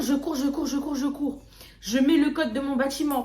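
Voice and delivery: usual speaking voice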